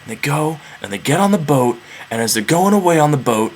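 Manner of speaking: hushed telling of the escape; quiet. A narrator's reading. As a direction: drop the voice to sneak away. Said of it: "being super quiet".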